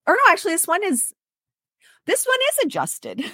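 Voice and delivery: sudden high surprised correction. Real, not acted. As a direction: catch yourself, surprised and high. Catching her own mistake.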